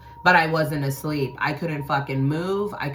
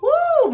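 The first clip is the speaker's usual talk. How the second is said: high, loud whoop of praise; a sudden cry of joy; excited woo; 8 dB louder than usual. Real, not acted. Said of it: "God has showed up".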